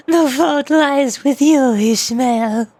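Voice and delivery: slow mock-mad accusation; crazy insane person. Explaining the joke to viewers, crazy insane person. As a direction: stretch out the words like a madman, dropping on the name. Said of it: "crazy insane person voice".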